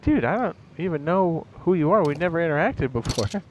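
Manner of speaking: high-pitched, baffled objection; acted bewildered protest. Streamer answering a stranger who hates them, bewildered protest. Voices bewildered reply to a hater.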